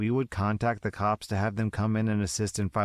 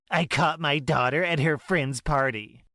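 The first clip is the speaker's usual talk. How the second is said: higher, scolding mother voice; accusing mother; 6 semitones higher, 4 dB louder than usual. Acted. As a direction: Karen scolding her daughter.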